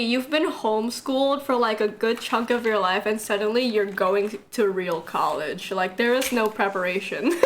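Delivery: ordinary talk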